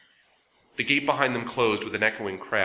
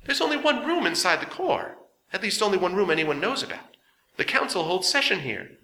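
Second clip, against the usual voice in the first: raised eager little robot voice; Redeman Ratchet voice; 11 semitones higher than usual. A narrator's reading. Small robot guide explaining the core, high voiced. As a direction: pitch it high and chirpy for Ratchet.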